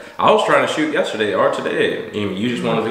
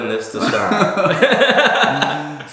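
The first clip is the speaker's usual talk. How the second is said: mock announcer; much slower than usual. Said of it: "mock serious sign-off".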